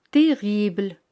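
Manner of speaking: terrible said the French way, rolled uvular r, silent final e; French pronunciation demo. Shown as a demonstration. Teacher models terrible in French for her students, carefully. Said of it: "La palabra terrible en francés".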